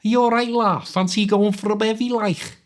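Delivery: broad, high-pitched Liverpool accent; stronger Scouse accent. Shown as a demonstration. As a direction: lay the Liverpool accent on thick. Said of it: "make my accent a little stronger".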